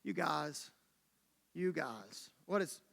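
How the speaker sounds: low, hushed mock exasperation; weary Jesus. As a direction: sigh it softly at hopeless friends. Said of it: "Jesus is probably like".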